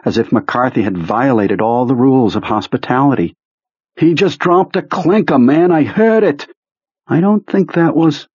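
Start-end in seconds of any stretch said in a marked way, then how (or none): 4.0-8.3 high, pleading insistence; Beaver's high insistent voice. Acted. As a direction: insist it desperately. Beaver insisting McCarthy alive.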